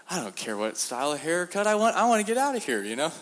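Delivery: high, loud rising exasperation; exasperated. Retold or imitated. Preacher in prison, exasperated over haircut choice. Desperate inner thought.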